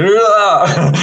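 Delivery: high, drawn-out shuddering cry; rising "ughhh" of electric sensation; imitated rushing shudder. Shown as a demonstration. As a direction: voice a tingle rushing up the body. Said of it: "like water running up inside your body".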